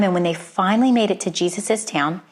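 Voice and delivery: ordinary delivery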